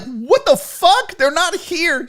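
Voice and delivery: high, slow, incredulous; stunned host echoing bad news; shocked disbelief. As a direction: repeat it as if you can't believe it. Learns the guests are missing.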